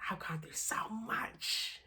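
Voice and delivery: breathless whisper to yourself; whispered self-affirmation. Real, not acted. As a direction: hush it like a secret promise. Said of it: "I have to say it so I believe it".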